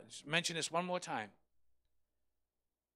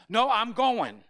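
louder, higher insistent refusal; stubborn old mother; 7 semitones higher, 12 dB louder, slower than usual. Retold or imitated. Elderly mother refusing her son, firm and loud. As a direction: dig in, she's going no matter what. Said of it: "her son will tell her".

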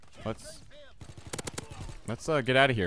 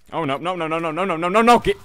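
rising panic that ends in a yell; no no no, climbing higher into a shout; panicked no's; 7 semitones higher, 8 dB louder than usual. Real, not acted. Player panics at getting stuck.

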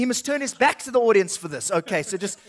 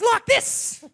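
much higher cartoon wolf voice; a wild puppet wolf yelling; 12 semitones higher than usual.

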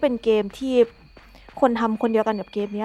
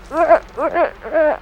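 slow strained grunts; 4 semitones higher, much slower than usual. Real, not acted. Straining at a game move.

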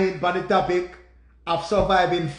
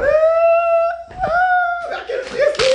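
high-pitched wailed cry, far above usual pitch; anguished wail; 5 dB louder than usual. Real, not acted. Man crying over Stacy's betrayal, anguished wail. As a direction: wail the name in real heartbreak.

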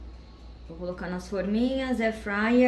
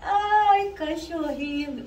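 cooing at dog; 9 semitones higher, much slower than usual. Real, not acted.

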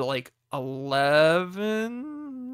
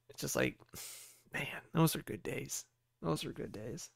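quiet nostalgia; 2 semitones lower, 13 dB quieter than usual. Real, not acted. Man recalling good old days with his cat, quietly nostalgic. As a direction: let it drop softly, lost in the memory. Looking back fondly on apartment days.

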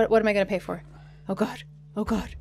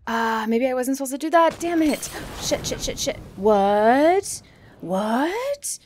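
panic; 4 semitones higher, 10 dB louder, slower than usual. Real, not acted. Panicking during a game fight.

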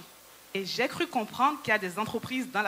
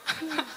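laugh; 5 semitones higher, 6 dB quieter than usual. Real, not acted. Laughs at her own joke.

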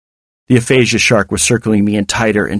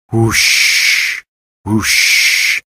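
breathy "whoosh" like surf; drawn-out whooshing wave noise; crashing waves. Acted. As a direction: imitate waves rolling in, unvoiced hush. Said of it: "sound of crashing waves".

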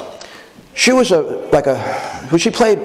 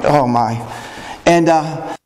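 ordinary talk

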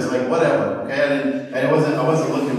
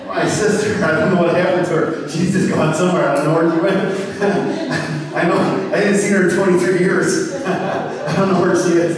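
laughing through the line, a touch higher and louder; laughing joke; 4 semitones higher, 4 dB louder than usual. Real, not acted. Preacher laughing to the congregation about his sister. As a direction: chuckle through a running joke.

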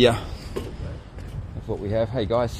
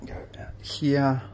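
whisper; 8 semitones higher, slower than usual. Real, not acted. Whispering on hotel tour.

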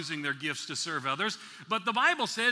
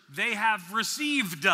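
slow, drawn-out "received" with a popped final d; past-tense emphasis; slower than usual. Shown as a demonstration. Preacher driving home the past tense, overdone ending on "received". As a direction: stretch "received" and release the d as "duh". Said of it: "how else do you emphasize the past tenseness".

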